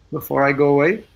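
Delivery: ordinary delivery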